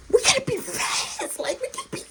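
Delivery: very high, strained urging; high-pitched mock panic; urgent squeal. Urging friend to hurry secretly.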